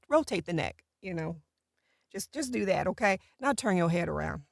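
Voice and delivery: usual speaking voice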